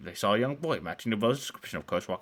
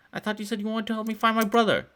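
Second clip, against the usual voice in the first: put-on upset girl; 13 semitones higher, 5 dB louder than usual. Girl argues with Jake.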